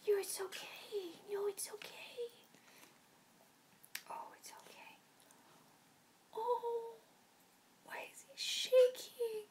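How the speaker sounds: high, soft whispered comfort; tender whisper; soothing whisper. Real, not acted. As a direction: hush the scared puppy. Whispering to shaking dog.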